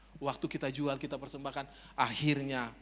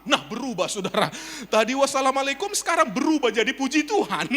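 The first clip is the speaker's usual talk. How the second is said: loud, high, breaking into laughter; high-pitched, laughing disbelief; amused exclamation; 11 semitones higher, 11 dB louder than usual. Real, not acted. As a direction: crow it to the crowd and end laughing. Amused at the changed greeting.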